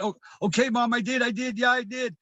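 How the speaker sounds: put-on high, desperate child giving in; retold crying confession. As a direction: imitate a crying child's frantic surrender. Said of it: "I started crying super hard and said".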